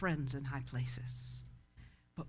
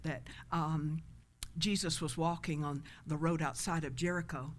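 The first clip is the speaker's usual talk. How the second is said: ordinary talk, nothing marked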